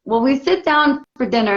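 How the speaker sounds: usual speaking voice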